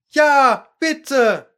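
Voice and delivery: high, drawn-out pleading call; lightly voiced crowd chiming in; imitated chorus. A narrator's reading. Others echoing Alice, chorused plea. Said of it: "die anderen wiederholten im Chor".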